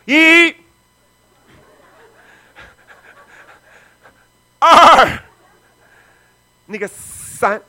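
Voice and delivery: loud, drawn-out countdown, high; slow loud count. Retold or imitated. Preacher counting down to the altar call, slow loud count. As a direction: stretch each number like a threat.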